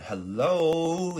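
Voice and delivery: high, loud, drawn-out hello; sudden cheery hailing. Acted. Girl pops up beside aiming assassin, loud singsong hailing. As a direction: pop up with a stretched greeting.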